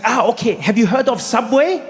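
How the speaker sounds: put-on helpful friend in a German accent. Friend suggesting where to eat, German accent. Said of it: "He's like".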